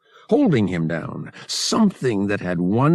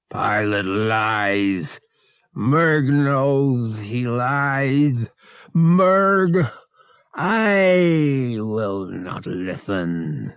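very slow, drawn-out growl, swelling louder; stubborn Togorian beast; 3 semitones higher, 3 dB louder, much slower than usual. A narrator's reading. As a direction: refuse in a slow, heavy creature voice. Merg refuses Han's plea.

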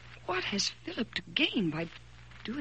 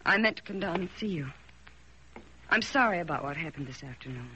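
ordinary talk

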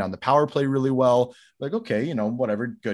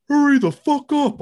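loud, high-pitched yell imitating the coach; 8 dB louder than usual. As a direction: bellow it impatiently, as if from the bench. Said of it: "I hear him yelling at me from the bench".

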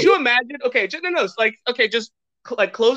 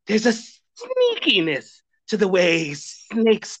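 "sneakiness" with a long, hissed S; sneaky snake; 4 semitones higher, slower than usual. Shown as a demonstration. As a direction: slither slowly through "sneakiness", stretching the S. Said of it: "there's also, like, a sneakiness".

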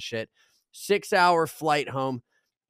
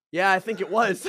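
slightly hammed-up driver quote; imitating unbothered driver; 6 semitones higher, 3 dB louder than usual. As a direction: play the driver answering as he drives on. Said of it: "he's like this".

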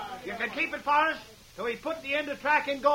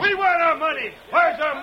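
loud, furious demand for the money; angry shout; 2 semitones higher, 8 dB louder than usual. Acted. Worker shouting at the boss for pay, loud and furious. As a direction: bellow at the boss for the wages.

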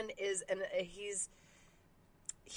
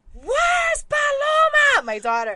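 frantic shout; 13 semitones higher, 9 dB louder than usual. Retold or imitated. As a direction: yell for the missing daughter. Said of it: "And I'm like".

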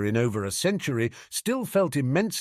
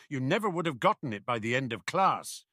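ordinary delivery

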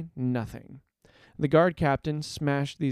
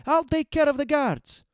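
high-pitched heroic shout; urgent cry; 13 semitones higher, 4 dB louder than usual. A narrator's reading. Heroine covers the hostages' escape, crying out. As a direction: call it out high over the fight. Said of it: "Zeri cried".

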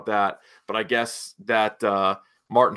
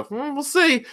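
knowing tease. Retold or imitated. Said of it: "I go".

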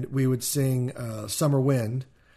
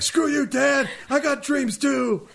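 mock angry son; 13 semitones higher, slower than usual. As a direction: scream back like a hurt teenage son. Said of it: "I'd be like".

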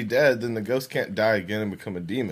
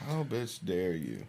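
quiet mumble; 6 semitones lower, 11 dB quieter, slower than usual. Acted. Repeating the slurred angry line.

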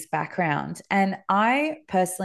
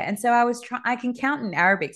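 normal talking voice

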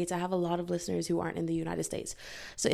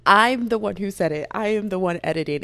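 usual speaking voice

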